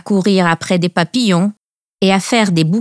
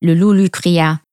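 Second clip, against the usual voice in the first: ordinary delivery, nothing marked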